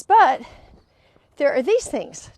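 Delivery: sudden high, loud but; quoted objection. Retold or imitated. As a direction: jump up on the but, then settle.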